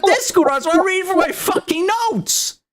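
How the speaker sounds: outraged, high-voiced protest; exasperated outburst. Real, not acted. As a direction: yell your complaint at a high pitch. Still ranting about being moved.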